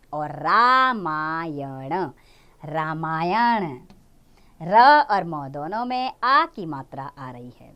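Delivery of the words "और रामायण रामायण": रामायण said slowly and lower, each आ long; slow low demo. Shown as a demonstration. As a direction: stretch रामायण out clearly. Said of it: "इस तरह आपने उच्चारण करना है".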